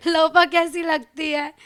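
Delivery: usual speaking voice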